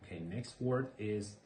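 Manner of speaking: ordinary delivery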